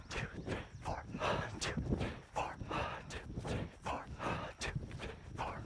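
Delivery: very soft whispered rhythmic count; breathy counting. Real, not acted. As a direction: count on the breath, keeping the running cadence. Coach counting along with runner's breaths.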